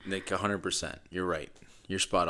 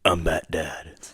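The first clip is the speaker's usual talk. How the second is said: Bat Dad voice; 2 semitones lower, slower than usual. Shown as a demonstration. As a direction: growl it like a superhero dad. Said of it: "I want you to show me and say".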